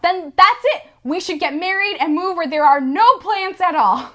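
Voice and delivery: eager Seymour. Retold or imitated. Seymour proposing.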